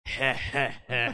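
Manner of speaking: a smug heh heh heh; snickering. Retold or imitated. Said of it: "Garb just going".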